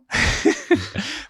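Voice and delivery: high amused chuckle at himself; gleeful laugh. Real, not acted. Telling the interviewer about the hotel's turnaround, a high gleeful laugh. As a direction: laugh at how the tables turned. Laughs at his own triumph.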